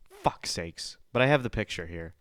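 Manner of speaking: usual speaking voice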